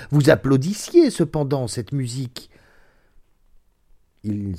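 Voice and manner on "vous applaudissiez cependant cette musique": loud, raised-voice objection; sudden exclamation. A narrator's reading. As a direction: cry it out in protest. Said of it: "s'écria-t-il". (il s'écrie, voix forte et haute)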